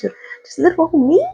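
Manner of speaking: high, small, unsure voice; tiny high-pitched "little old me" voice; timid newcomer. Acted. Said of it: "That's how she looks".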